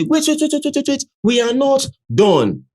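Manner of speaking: high, urgent mock protest; wait, wait, quoting them high; retold protest. Streamer retelling the performers, a high mock protest. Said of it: "Then they come talk, say".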